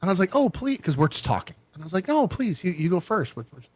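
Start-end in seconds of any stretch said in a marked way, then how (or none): 0.4-0.8 high-pitched courteous urging; mock politeness. Man urging his date to eat first, mock polite. 2.1-3.3 high, courteous insistence; mock politeness. Man letting his date eat first, mock polite.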